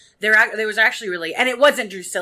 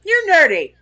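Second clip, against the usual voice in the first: high, loud, dismissive villain voice; bossy Spike voice; 8 semitones higher, 7 dB louder than usual. Retold or imitated. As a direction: wave him off like a lazy boss. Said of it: "He's like".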